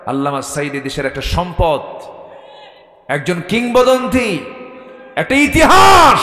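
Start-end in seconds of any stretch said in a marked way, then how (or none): 3.1-6.2 slow, high cry building to a roar; impassioned shout. Real, not acted. Preacher hails a scholar to the crowd, building shout. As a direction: stretch each word, swelling to a full shout.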